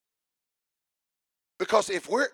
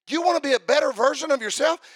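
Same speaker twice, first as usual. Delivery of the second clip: higher, louder, disbelieving echo; incredulous; 9 semitones higher, 7 dB louder than usual. Acted. Preacher mocking a convert's answer, incredulous and loud. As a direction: throw their words back in disbelief.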